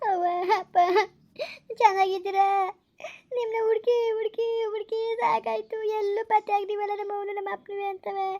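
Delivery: weep to long-lost parents, pausing between phrases; sobbing, grief-stricken reunion; tearful lament. Acted. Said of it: "ಅಳ್ಬೇಡಿ".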